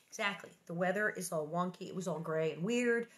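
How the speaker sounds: ordinary delivery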